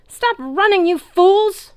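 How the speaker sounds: very high enraged roar; shrill commanding fury; furious yell. A narrator's reading. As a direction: scream at fleeing troops. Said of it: "Kronos yelled".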